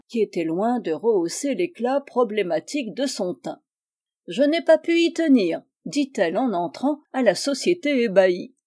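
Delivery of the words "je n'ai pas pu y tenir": high, loud, sustained outburst; character voice pitched well up; gushing intruding spinster. Acted. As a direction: burst in with a high-pitched excuse.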